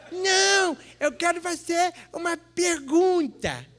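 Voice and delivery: very high, slow put-on female voice; insistent woman. Woman tells the priest she has a question, high comic insistence. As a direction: draw it out high, a caricatured parishioner. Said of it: "chegou perto de mim uma mulher".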